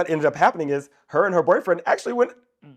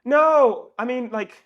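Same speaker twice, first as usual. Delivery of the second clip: high slow prying imitation; 6 semitones higher, slower than usual. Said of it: "she's like".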